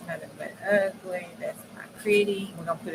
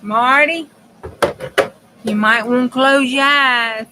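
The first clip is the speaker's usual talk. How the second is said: louder, higher call across the room; 4 semitones higher, 12 dB louder, slower than usual. Real, not acted. Warning Marty to look away, calling out loudly. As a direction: call out to Marty over the noise.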